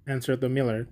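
plain reading voice

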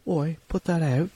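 high-pitched mock British voice; the Queen. Monarch ordering a show on air, mock British. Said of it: "maybe the Queen did. She's like".

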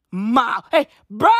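usual speaking voice

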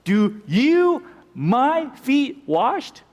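"you" and "my" said high and slow, side by side; pronouns pushed together. Shown as a demonstration. Peter to Jesus, aghast, high and slow, leaning on "you my". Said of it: "originally they were put together, the two pronouns".

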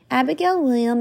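ordinary delivery

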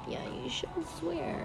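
soft unvoiced whisper; whispered quote. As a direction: hush it like a scolding boss. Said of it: "they were like".

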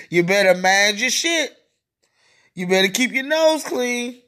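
normal talking voice

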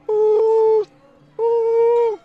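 high, loud steam whistle woo; train whistle toot. Retold or imitated. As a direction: toot like a tiny steam engine. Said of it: "steam engine type things tooting".

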